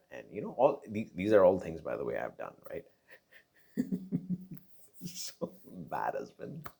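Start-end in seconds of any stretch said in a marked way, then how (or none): 3.8-4.6 breathy laugh. Real, not acted. Laughs at own bad behaviour.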